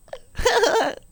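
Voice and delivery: high-pitched giggle; helpless high giggling; real laughter. Real, not acted. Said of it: "Why am I laughing?".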